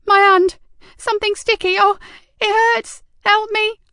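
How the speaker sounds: high, loud panicked cry for help; cry of pain. A narrator's reading. Burned receptionist begging the sergeant for help, panicked cry.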